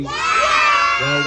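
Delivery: very high, loud squawk of a puppet; shrill puppet-show squawk; squawky puppet. Acted. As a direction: blurt it out like a shrieking Punch puppet.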